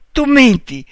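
loud, high retort; angry accusation. A narrator's reading. Marta refuses to believe him, loud angry accusation. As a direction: throw the accusation out forcefully.

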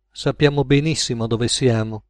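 usual speaking voice

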